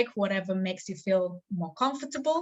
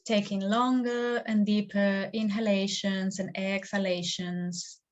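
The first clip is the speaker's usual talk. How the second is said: guided meditation. Acted. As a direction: lead them gently into stillness, stretching the words.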